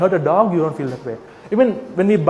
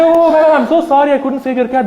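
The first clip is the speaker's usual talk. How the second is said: mock apology; 8 semitones higher, 5 dB louder than usual. Mock apology in story, raised pitch.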